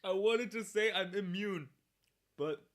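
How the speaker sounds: talking through the wasabi burn; after eating wasabi. Real, not acted. Just ate wasabi, burning.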